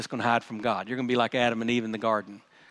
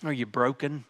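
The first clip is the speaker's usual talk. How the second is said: normal talking voice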